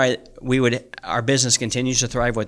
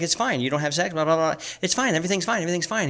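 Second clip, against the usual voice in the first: imitating the wife; 5 semitones higher, 4 dB quieter, faster than usual. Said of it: "She's like".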